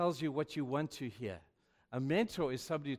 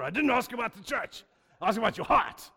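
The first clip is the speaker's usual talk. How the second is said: louder, a hint of a firm old pastor cutting in; stern mentor; 7 semitones higher, 5 dB louder than usual. Charles Gordon cutting off the pastor's dodge, firm and louder. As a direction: press the point firmly like a stern mentor. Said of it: "when he spoke, he growled".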